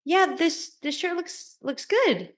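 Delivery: high, hesitant statement fishing for approval; tentative high voice, secretly asking; questioning undertone. Retold or imitated. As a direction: stumble over it, pitched high, angling for validation. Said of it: "the undertone is a question".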